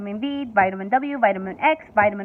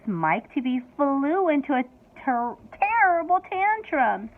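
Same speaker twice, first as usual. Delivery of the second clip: ordinary delivery, nothing marked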